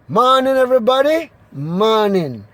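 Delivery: ordinary talk, nothing marked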